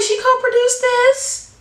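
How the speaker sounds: high, drawn-out question of surprise; surprised. Retold or imitated. Retold surprise at reading credit.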